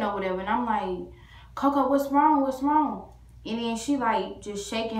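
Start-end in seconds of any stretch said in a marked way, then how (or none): 1.5-3.1 high, louder, urgent repeated question; retold alarm, voice lifted; worried calling. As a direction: plead anxiously with a hurt pet. Frantic owner questioning hurt dog.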